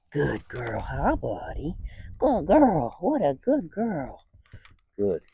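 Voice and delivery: gently love on the dog; calm greeting. Shown as a demonstration. Trainer showing owner a calm praise greeting. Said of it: "I wanna focus on a calm greeting".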